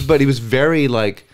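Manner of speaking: usual speaking voice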